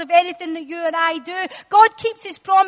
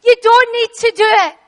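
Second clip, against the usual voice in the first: higher, louder urgent call; voice of God to Abraham; 6 semitones higher, 7 dB louder than usual. Acted. God's voice calling to Abraham at the altar, an urgent higher plea. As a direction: stop him mid-act. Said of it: "the familiar voice speaks to Abraham".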